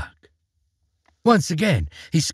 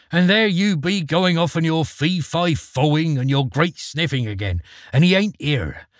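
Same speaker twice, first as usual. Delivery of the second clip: ogre's wife scolding; 3 semitones higher than usual. Acted. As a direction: tick him off like a fed-up wife.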